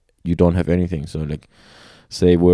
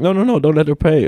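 protesting; 9 semitones higher, 4 dB louder than usual. Retold or imitated. Protesting at the kiosk.

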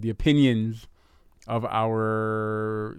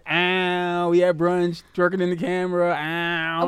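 hyped brunch party voice; higher mock party cheer; party girl voice; 6 semitones higher than usual. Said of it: "a lot of the brunch videos is".

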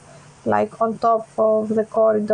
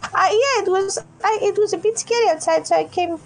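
put-on higher, pleading child voice; lost little girl; 7 semitones higher than usual. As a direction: play a scared kid who lost her dad. Opal child voice continues.

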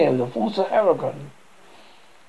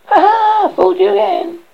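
high, loud prankster's gloating; gleeful taunt; 7 dB louder than usual. Acted. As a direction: crow over the victims of your trick. Boy taunts the tricked pigs.

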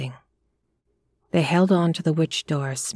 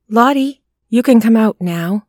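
loud, higher coaxing call; 5 semitones higher, 9 dB louder than usual. A narrator's reading. Couple coaxing Lottie from behind the witch door, loud. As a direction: coax her out through the door.

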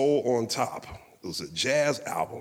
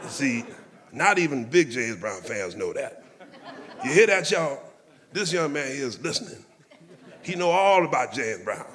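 higher, louder, laughing boast to the room; 5 semitones higher, 3 dB louder than usual. Retold or imitated. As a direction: show off the young man to everyone. [name] boasting to the table.

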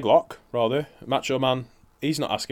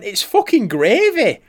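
gleeful reveal; 11 semitones higher, 7 dB louder, slower than usual. Retold or imitated. Telling boss the prank.

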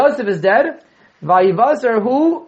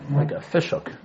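dropped soft, almost to himself; soft repeat of a fishhook image; quiet echo; 3 semitones lower, 8 dB quieter than usual. Shown as a demonstration. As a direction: let the repeat sink in quietly. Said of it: "teacher repeats the image, softer".

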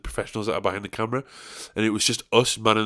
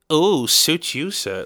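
higher, louder, drawn-out put-on voice; mock catchphrase; 6 semitones higher, 5 dB louder, much slower than usual. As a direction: draw it out loud like a cheeky catchphrase.

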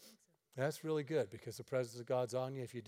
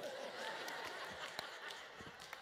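laughing sigh; 12 semitones higher, 13 dB quieter than usual. Real, not acted. After the punchline.

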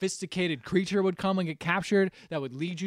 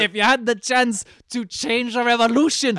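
loud, high, hammed-up Scottish accent; mock Scottish accent; 7 semitones higher, 9 dB louder than usual. Challenging Shrek on the revolution, loud Scottish accent. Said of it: "The Scottish accent has arrived".

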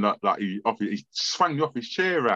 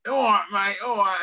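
higher-pitched Cockney greeting; lightly put-on Cockney voice; Cockney accent; 7 semitones higher than usual. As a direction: imitate a Cockney gangster's hello. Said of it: "talks Cockney".

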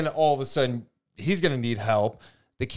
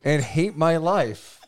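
reading through a laugh; half-laughing read-out; laughing while reading; 2 semitones higher, slower than usual. Retold or imitated. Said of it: "Morgan was on one".

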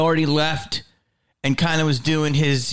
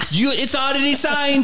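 high-pitched imitated hard-sell club owner; Jamie Masada; 9 semitones higher than usual. Club owner selling signed DVDs, imitated high insistent voice. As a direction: push the sale in a mock high voice. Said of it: "he signed them all and go".

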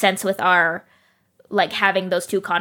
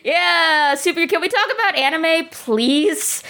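higher-pitched, mildly hammed-up plea; pleading co-host; 6 semitones higher than usual. Co-host begs to talk anime instead, mock pleading. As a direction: lightly act out a reluctant co-host begging.

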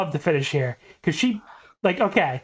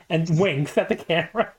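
ordinary talk, nothing marked

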